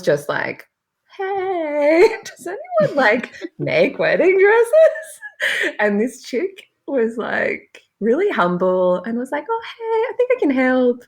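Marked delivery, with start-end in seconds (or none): 1.2-5.7 much higher, laughing, drawn out slowly; mock excited post. Retells her Facebook post. 9.5-11.0 much higher, light and humble offer; sweet helper voice. Retold or imitated.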